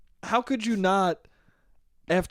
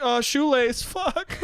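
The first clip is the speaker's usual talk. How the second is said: higher, flustered mock voice; panicked blurt; 9 semitones higher, much slower than usual. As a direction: let it slip, then catch yourself. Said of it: "He's like".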